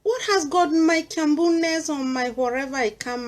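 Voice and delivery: usual speaking voice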